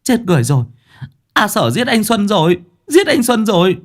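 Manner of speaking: shrill panicked scream for help. A narrator's reading. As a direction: scream out in terror. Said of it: "kêu lên".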